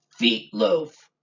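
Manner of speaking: a lightly voiced monster, loud; a hint of a hungry monster; hungry thing voice. A narrator's reading. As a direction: announce the food like a creature. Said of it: "answered the hungry thing".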